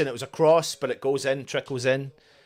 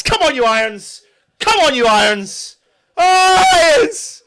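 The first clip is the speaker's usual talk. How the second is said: high, loud terrace cheer; rallying fan shout; 11 semitones higher, 7 dB louder than usual. Real, not acted. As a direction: roar the team on in celebration. Cheering team after goal.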